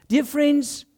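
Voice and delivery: normal talking voice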